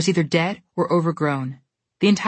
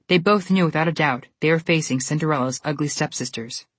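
ordinary delivery, nothing marked

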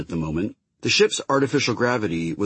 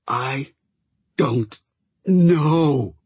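insistent, higher, last word wheezed out; emphatic wheeze; 7 semitones higher, 4 dB louder, much slower than usual. Acted. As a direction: push it hard till the last word wheezes. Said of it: "so emphatically that the last word came out as a wheeze".

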